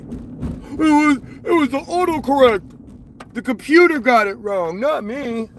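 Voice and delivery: whiny mock excuse. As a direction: mimic a whining excuse-maker. Mimicking commenter's autocorrect excuse.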